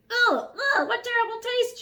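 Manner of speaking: high, loud spluttering disgust; choking. Acted. Dragon choking on a tangerine, high and loud. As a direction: choke it out like bad food. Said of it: "choked the dragon".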